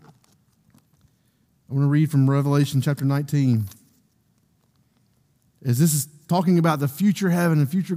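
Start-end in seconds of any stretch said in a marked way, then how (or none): none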